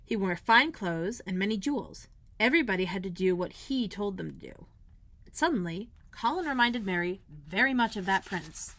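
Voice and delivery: ordinary delivery, nothing marked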